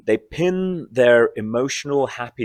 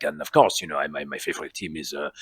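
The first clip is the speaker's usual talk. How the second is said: lower, imitated Belgian accent as Poirot; 6 semitones lower, 5 dB quieter than usual. Actor retelling his co-star turning into Poirot, imitated Belgian accent. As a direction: slip into a lightly done Belgian accent mid-chat. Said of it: "he just was suddenly in the Belgian accent".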